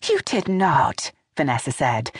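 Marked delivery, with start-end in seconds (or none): 0.1-1.1 slow, shocked denial. A narrator's reading. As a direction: let the shock stretch the words out. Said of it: "shocked by the admission".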